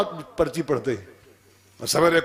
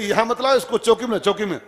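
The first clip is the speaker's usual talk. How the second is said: higher, insistent acted order; mildly hammed-up official's order; waving him away; 7 semitones higher than usual. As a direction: send them off impatiently, voice raised in pitch.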